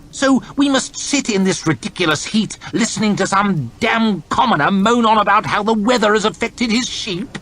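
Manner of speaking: high, peevish lordly complaint; haughty lord. Acted. Lord chamberlain grumbling about the heat, peevish. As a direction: grumble like a spoiled noble.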